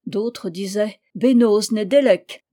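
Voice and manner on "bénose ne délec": called-out greeting. A narrator's reading. Passers-by call out a greeting.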